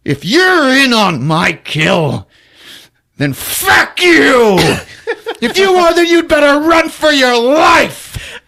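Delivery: high, loud mock rage, slow; hammed-up yell. [name] yelling threats at Lisa, hammed-up rage. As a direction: bellow it like a wild threat.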